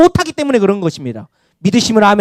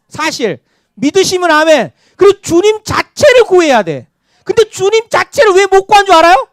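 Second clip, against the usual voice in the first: usual speaking voice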